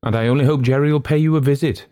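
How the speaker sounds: quick, high, nervous parting shot; nervy and hurried; nervous man. A narrator's reading. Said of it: "added the nervous man".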